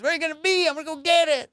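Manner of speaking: high-pitched pleading question; mock desperate plea. Buddy asking officers their whereabouts, mock desperate.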